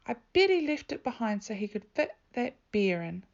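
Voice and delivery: loud, sharp blame; accusing big sister. A narrator's reading. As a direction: tattle on him loudly. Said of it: "said his big sister".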